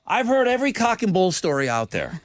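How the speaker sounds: lightly voiced scolding mom. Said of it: "this is what my mom would say".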